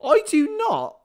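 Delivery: high, loud protest; indignant denial. Real, not acted. Streamer insists they aren't posh, indignant.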